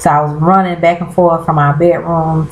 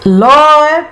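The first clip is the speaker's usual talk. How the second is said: high, loud Lord; exasperated exclamation; 9 semitones higher, 5 dB louder than usual. Real, not acted. Vlogger telling viewers about the twist, loud Lord. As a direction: cry out to heaven in disbelief.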